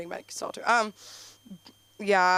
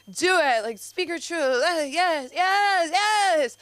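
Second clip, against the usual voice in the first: high, louder cheering yeses; hype cheer; 9 semitones higher, 4 dB louder, slower than usual. Retold or imitated. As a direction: pump them up, high and loud. Cheering women on.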